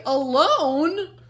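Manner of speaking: loud, rising alarmed gasp; Buster, horrified at being left alone, gasping. A narrator's reading. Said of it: "gasped Buster".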